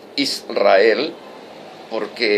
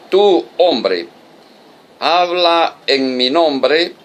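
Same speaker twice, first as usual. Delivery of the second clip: loud, high, emphatic preaching; preaching at full force; 5 semitones higher, 4 dB louder than usual. Acted. Preacher proclaiming scripture to the congregation, loud and high. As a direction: proclaim the prophet's words to the flock.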